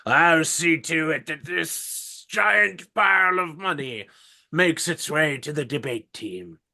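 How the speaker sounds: put-on slow, high wavering old man. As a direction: stretch every word like a frail old cleric. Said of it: "I really hurt my throat doing the old man's voice".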